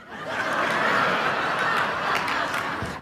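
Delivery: high breathy burst of laughter; big laugh. Real, not acted. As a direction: crack up at your own joke. Laughs at his own joke.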